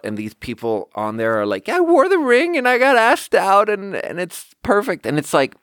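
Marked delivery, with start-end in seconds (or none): 1.7-5.0 high, mock-gushing voice; gushing ad testimonial. Ad customer raving about the ring, high mock gushing. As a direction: imitate an overjoyed customer in an ad.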